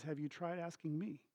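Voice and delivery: very soft question; hushed, understated delivery; gentle dad. Retold or imitated. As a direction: imitate a father quietly making his point. Dad gently makes his point.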